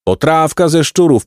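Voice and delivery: high hawker's call, weight on ze; hawker's cry. A narrator's reading. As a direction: sing out the stew to passers-by. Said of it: "wołał inny".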